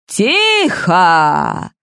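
a mild gruff bark; гаркнуть, чтобы прекратить драку; barking command. A narrator's reading. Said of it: "гаркнула Розалия". (резко гаркнуть)

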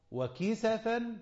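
وكسفا said "wa-kisafan", a after the s; وكسفا as wa-ki-sa-fan; plural form, fatha. Shown as a demonstration. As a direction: open the s in وكسفا with a short a. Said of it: "وكسفا الجمع".

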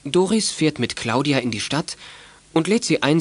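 normal talking voice

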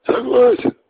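drawn-out shout. Acted. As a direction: stretch the word out as a yell. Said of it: "протянул Макс".

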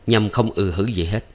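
plain reading voice

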